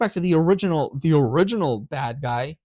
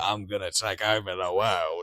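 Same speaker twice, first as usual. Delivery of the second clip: lower, quieter cartoon villain purr; mustache-twirling villain; 7 semitones lower, 4 dB quieter than usual. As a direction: plot world domination like a cheesy baddie. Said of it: "a mustache twirling kind of like".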